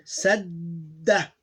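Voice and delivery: "sadda" with a held d and short final a; teacher's deliberate demo of double d in "sadda"; doubled consonant. Shown as a demonstration. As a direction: linger on the middle d of "sadda". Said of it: "these two become one".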